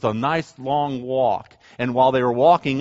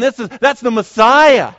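high, drawn-out emphatic declaration; loud proclamation; 8 semitones higher, 11 dB louder than usual. Retold or imitated. Preacher voicing the blind man's declaration, loud proclamation.

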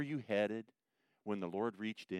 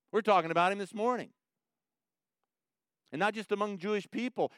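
full-force preaching; 7 semitones higher, 7 dB louder than usual. Acted.

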